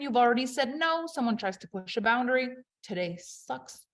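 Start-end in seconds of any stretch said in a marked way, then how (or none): 2.8-3.8 muttered sulk. Pretend. Sulky summary of bad day.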